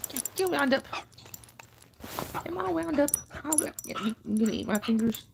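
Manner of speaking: high, playful pet-talk voice. Real, not acted. Owner teases the dog nibbling her fingers, high baby talk. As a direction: tease the puppy, sing-song.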